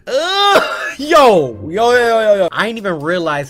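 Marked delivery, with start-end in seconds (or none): 0.1-0.7 sharp high ugh of disgust; disgusted groan. Real, not acted. Grossed out by the whispery clip, a high disgusted ugh. 1.0-2.5 loud, higher string of yo's; alarmed yo. Real, not acted. Rattled by the clip, loud alarmed yo's. Reacting to creepy ASMR.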